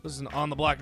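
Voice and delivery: usual speaking voice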